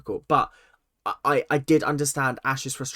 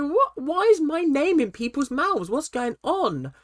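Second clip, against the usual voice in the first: much higher, bewildered, imitating Hannah; shocked confusion; 11 semitones higher than usual. Hannah hearing her name thrown around, high and bewildered. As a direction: act out her alarm at being named.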